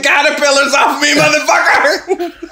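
furious plant. Pretend. Plant raging at caterpillars.

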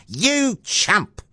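a mildly yelled insult, pitched high; mock yell. A narrator's reading. Mother yelling at Jack over the bean, mock yell. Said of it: "she yelled".

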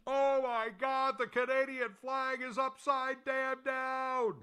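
high, drawn-out mock holler; imitated yell. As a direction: mimic someone yelling across a press box, stretched and high-pitched. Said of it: "somebody at the press box yells out".

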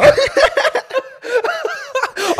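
real high-pitched burst of laughter. Real, not acted. Laughing at a mate's drunk murder text, high-pitched. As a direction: crack up, squealing.

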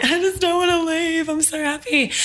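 mock sob in a very high-pitched wail; retold sobbing. Telling friend she doesn't want to leave, mock sobbing.

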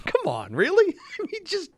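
high-pitched incredulous protest; exasperated disbelief. Real, not acted. Co-host scoffing at panic over a rookie, exasperated.

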